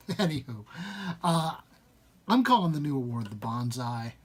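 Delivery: usual speaking voice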